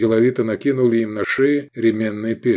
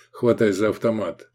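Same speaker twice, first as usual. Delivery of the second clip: usual speaking voice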